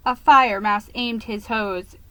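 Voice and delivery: ordinary delivery, nothing marked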